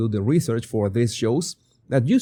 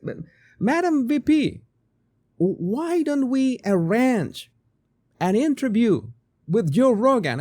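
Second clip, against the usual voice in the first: lightly voiced staffer making a higher-pitched suggestion; campaign team member; 9 semitones higher than usual. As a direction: pitch it up like an aide approaching the boss. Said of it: "when her team suggested".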